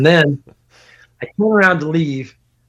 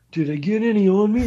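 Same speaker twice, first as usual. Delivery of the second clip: high-pitched questioning voice, rising at the end; imitating worried friend; 7 semitones higher than usual. Friend checking himself after the fall, high imitated question. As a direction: put on a higher, anxious asking voice. Fallen friend asks, acted out.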